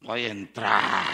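low, slow put-on voice; a slow sly mutter playing the man; sneaky churchgoer voice. Preacher acting the intruder, low and slow.

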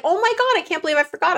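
high, bright burst of dismay; a husband's high startled apology; sudden dismay. Retold or imitated. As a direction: catch yourself, suddenly mortified at forgetting. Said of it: "and he was like".

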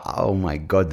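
ordinary delivery

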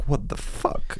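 whispered disbelief. Retold or imitated. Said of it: "I was like".

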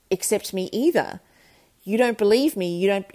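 ordinary talk

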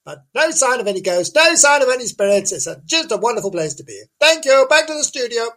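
high-pitched comic lady reporter; fluting on-the-spot correspondent, put on; Janice. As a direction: play a dotty reporter in a high voice. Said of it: "we were gonna talk to Janice".

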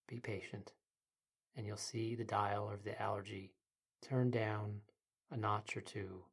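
soft, quiet meditation guide; sleep story narrator. Acted. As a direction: ease the listener into calm.

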